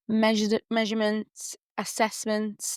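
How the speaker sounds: ordinary talk, nothing marked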